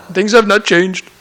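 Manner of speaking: imitated Indian English, joking; Indian gentleman. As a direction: deliver the punchline as an older Indian gentleman. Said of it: "the Indian gentleman's there".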